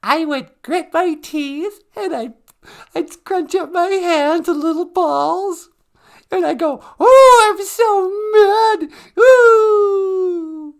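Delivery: high, slow, whiny mock fury with a cry in it; hammed-up fake anger. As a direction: overact a tantrum like a bad acting student. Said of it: "how would you act mad?".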